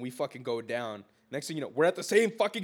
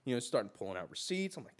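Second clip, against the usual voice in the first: ordinary talk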